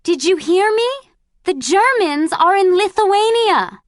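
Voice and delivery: joyful shout. Acted. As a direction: shout the news to the whole car. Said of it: "she shouted".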